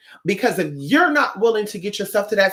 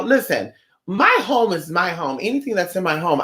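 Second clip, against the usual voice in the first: ordinary talk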